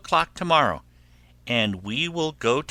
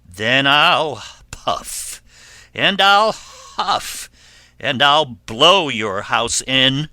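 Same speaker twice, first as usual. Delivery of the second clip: loud, slow, swelling threat; wolf's threat; 3 semitones higher, 6 dB louder, slower than usual. Acted. Wolf threatens pig in straw or furze house, loud menace. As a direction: stretch out each threat loudly.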